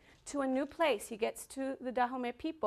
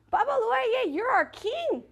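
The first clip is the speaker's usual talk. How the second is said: higher, louder proclamation; hailing a king; 8 semitones higher, 5 dB louder, slower than usual. Acted. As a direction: crown him with your voice. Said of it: "And they said".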